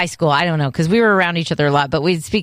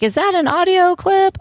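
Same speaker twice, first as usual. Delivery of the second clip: much higher, amazed question; mock surprise; 12 semitones higher than usual. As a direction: act stunned it might be a recording. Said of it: "I was like".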